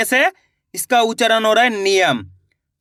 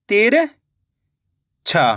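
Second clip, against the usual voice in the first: तिरछा stretched very slowly, syllable by syllable; drawn-out sounding out; much slower than usual. Shown as a demonstration. Teacher sounding out तिरछा very slowly for the class to spell. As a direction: pull तिरछा out long so pupils hear each sound. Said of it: "किसका उच्चारण निकल रहा".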